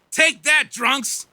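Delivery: mock shouted taunt; kid's taunt. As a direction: yell it like a cocky kid throwing rocks. Kids yelling back at drunks.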